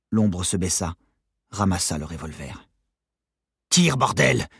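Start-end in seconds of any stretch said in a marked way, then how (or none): 3.7-4.5 high, loud urgent shout; shouted order. A narrator's reading. Detainee to accomplice holding the gun, shouted order. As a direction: yell at your accomplice to act. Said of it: "cria Novakovski".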